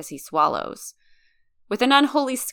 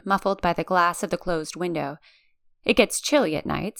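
usual speaking voice

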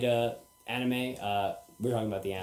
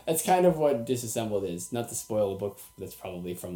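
ordinary delivery

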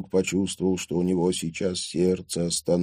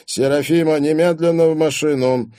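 loud, higher-pitched command; stern order; 9 semitones higher, 7 dB louder than usual. A narrator's reading. Father orders his daughter to the car, sternly. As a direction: order her off at once.

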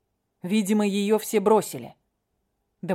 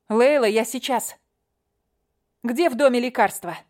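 urgent; 5 semitones higher, 3 dB louder than usual. Acted. Katya urgent, calling.

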